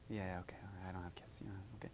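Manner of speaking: a quick private aside to the front row; whisper. Real, not acted. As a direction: let it slip out under breath. Aside while asking audience.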